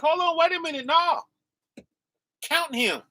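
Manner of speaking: high-pitched interjection, imitating an objection; imagined Christ objecting. Preacher voicing Christ stopping the discarding of his elect, objecting. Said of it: "Christ is like".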